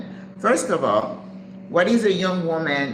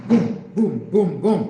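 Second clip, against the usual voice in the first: punchy booms, louder and raised; imitated message bursts; 4 semitones higher, 4 dB louder than usual. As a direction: sound out each arrival as a boom. Imitates messages arriving at once.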